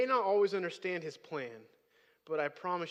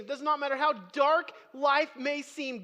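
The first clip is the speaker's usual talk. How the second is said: high, loud preaching with punched key words; emphatic preaching; 7 semitones higher, 8 dB louder than usual. Real, not acted. Preacher assuring the congregation God rules, emphatic preaching. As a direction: preach it with full conviction.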